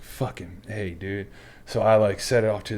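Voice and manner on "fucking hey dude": quiet, much softer hissed call to a buddy; hushed aside. Retold or imitated. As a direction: keep it low so the instructor won't hear. Whispering to buddy during ironing.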